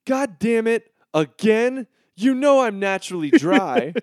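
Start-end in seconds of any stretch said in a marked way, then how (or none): none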